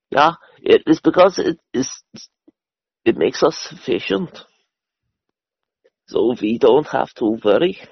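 put-on crisp German-accented justification. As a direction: explain it in a mock German voice. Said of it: "you were doing a German voice".

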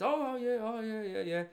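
put-on high, flustered Indian accent yeahs; a caught-out driver's nervous Indian-accented yeahs; mock Indian accent. Taxi driver answers, mocked.

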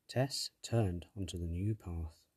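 usual speaking voice

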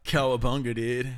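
low, lazy surfer-turtle; Ninja Turtle voice. Pretend. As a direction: Cowabunga like a turtle dude. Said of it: "You watched the Ninja Turtles".

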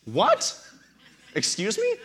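high astonished laugh-yelp at a friend's claim; shocked disbelief. Retold or imitated. Retold shocked reply to friend.

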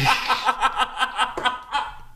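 high wheezing cackle; can't stop laughing; hysterical laugh. Real, not acted. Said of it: "Why are you laughing so hard".

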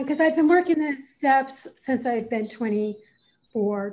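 ordinary talk, nothing marked

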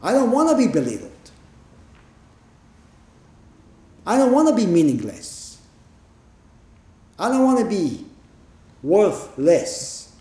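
mock plaintive refusal, falling at each end; protesting. Voices the mind's protest.

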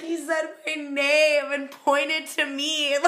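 high, slow mock-tearful wail; overwhelmed fan crying with joy, hammed up; imitated crying. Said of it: "she's, like, crying".

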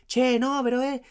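high-pitched Argentine shouting, Che, no; shouting Argentine street argument; mock Argentine yelling. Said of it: "se empezaron a gritar".

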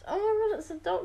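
sudden high gasp of recognition, a playful reenactment; oh my God, it's him!; mock surprise. Viewer realising the milkman is the Doctor, mock surprised.

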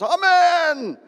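high, loud misplaced amen; shouted amen. Retold or imitated. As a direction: blurt it out from the pews. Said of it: "and somebody shouts".

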